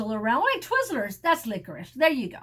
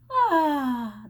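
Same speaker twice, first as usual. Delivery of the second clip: ordinary delivery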